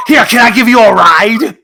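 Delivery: Charles offering a lift. Pretend. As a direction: play an over-eager offer, drawing it out. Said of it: "he's more like".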